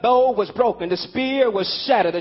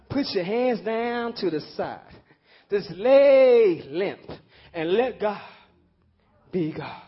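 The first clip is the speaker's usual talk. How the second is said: much softer, lower and unhurried; gentle reflective preaching; 5 semitones lower, 4 dB quieter than usual. Acted. Preacher guides the congregation to let go, softly. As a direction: ease the room into letting go.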